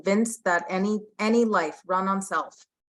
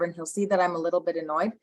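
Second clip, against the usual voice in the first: ordinary delivery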